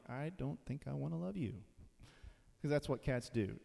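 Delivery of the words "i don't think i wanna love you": much softer, cool reply; aloof cat. Pretend. Preacher voicing an aloof cat turning down love, soft and cool. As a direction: brush them off quietly like a cat.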